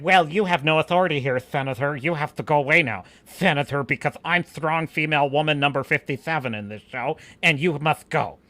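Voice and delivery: lisping, through-the-teeth mock acting; mock her stilted speech with clenched-jaw lisp; Vernestra. Mocking Vernestra dismissing the senator, lisping through teeth. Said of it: "because she's like".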